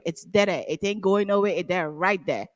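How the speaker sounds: low-pitched Guyanese-Jamaican lilt; Caribbean accent coming out. Shown as a demonstration. Showing how the accent comes out when upset, Caribbean accent. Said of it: "the accent is there".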